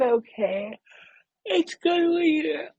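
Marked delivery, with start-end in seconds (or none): 1.5-2.8 talking through a big yawn; words stretched by a yawn. Real, not acted. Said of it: "That yawn is".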